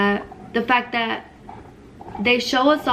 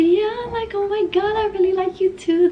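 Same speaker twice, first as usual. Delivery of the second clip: gushing excitement; 11 semitones higher than usual. Retold or imitated. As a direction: act out a smitten, excited yes. Eager girlfriend replaying her reply.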